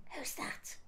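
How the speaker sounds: whispered aside, lost; confused whisper. Acted. Gran whispering to her daughter about her granddaughter. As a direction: ask it under your breath, not recognising her.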